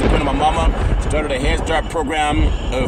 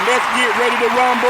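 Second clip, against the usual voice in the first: boxing ring announcer call, high and loud; stretched hype announcer voice; announcer catchphrase; 9 semitones higher, 3 dB louder than usual. Retold or imitated. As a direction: sell it like a ring announcer before the bout.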